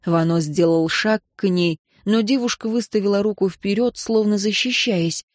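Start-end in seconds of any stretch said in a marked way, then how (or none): none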